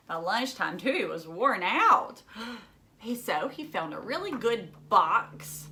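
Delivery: ordinary delivery, nothing marked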